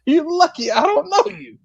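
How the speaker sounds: high, laughing mock threat through giggles; laughing retort. Telling the guy off with a laugh. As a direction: say it cracking up, pretending to be tough.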